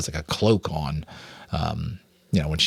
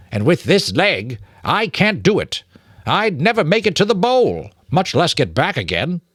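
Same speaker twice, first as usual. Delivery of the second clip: put-on a high, thin old-rat voice, hurt leg and all; Mister Ages, high and frail; 8 semitones higher than usual. Old rat with a broken leg, explaining, high and thin. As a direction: sound old and beaten by the injury.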